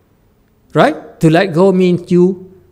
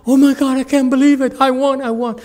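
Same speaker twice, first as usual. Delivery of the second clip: much higher and louder, disbelieving joy; overjoyed winner; 12 semitones higher, 7 dB louder, faster than usual. Retold or imitated. A stunned contestant to the crowd, ecstatic and much higher. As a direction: shout it like you just won the prize. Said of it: "he said".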